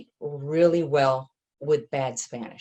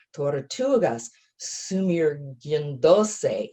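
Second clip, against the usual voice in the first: slow, savoring Spanish read-aloud; slow, careful Spanish reading; reading in Spanish with a US accent; slower than usual. Shown as a demonstration. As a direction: let each Spanish word roll out slowly. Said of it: "Okay, in Spanish".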